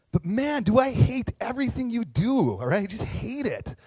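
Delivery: high, loud hammed-up hatred; mock hateful outburst. Preacher mocking judging Anna, hammed-up loud hatred. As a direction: suddenly blast the hate. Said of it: "going up to Anna and say".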